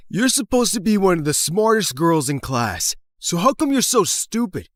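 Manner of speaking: high, mounting angry jeer; bullying taunt. Acted. Bully corners shy girl.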